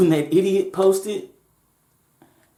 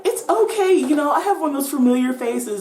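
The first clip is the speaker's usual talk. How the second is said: put-on girly high voice; 11 semitones higher, 4 dB louder than usual. Girl voiced high in story.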